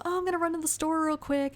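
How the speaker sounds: high, sing-out mom voice; unsuspecting mom. Retold or imitated. Mom announcing an errand to the house, high and unsuspecting. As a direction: call it out high and casual. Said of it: "you hear the mom go".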